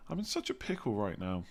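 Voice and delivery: soft, half-hearted mock English accent; failed English accent. Shown as a demonstration. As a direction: try a British accent quietly and not quite manage it. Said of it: "I was trying to do the accent. I can't do it".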